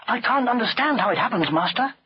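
high, blameless excuse; innocent servant boy. A narrator's reading. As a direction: plead ignorance to the master. Chulak pleads ignorance.

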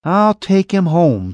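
reluctant daughter giving in, slow whine; whined. Acted. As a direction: drag it out like a sulky girl. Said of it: "she whined".

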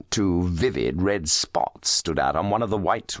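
plain reading voice

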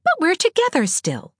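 put-on high, cheery girl's reassurance. A narrator's reading. Anne consoling lonesome Diana, cheerily. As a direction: lift it high to cheer up a friend. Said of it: "said Anne cheerily".

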